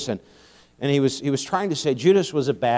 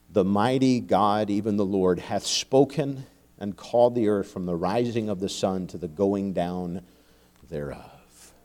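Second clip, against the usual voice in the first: lower, softer solemn reading of Isaiah; scripture reading; 7 semitones lower, 6 dB quieter than usual. Acted. Preacher reading Isaiah to congregation, solemn scripture reading. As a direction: let each phrase land slowly and reverently. Reads Psalm fifty.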